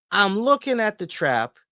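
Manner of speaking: usual speaking voice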